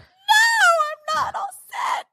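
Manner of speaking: very high, squealed wail; panicked refusal. Retold or imitated. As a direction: protest in a tearful, sky-high squeal. Retold panic before surgery.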